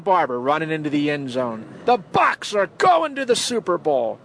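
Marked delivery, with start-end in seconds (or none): 1.8-4.2 much higher, jubilant mock play-by-play; light imitation of a championship call; imitating radio call. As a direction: shout it like the radio man's big moment. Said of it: "I always remember Gene Deckerhoff".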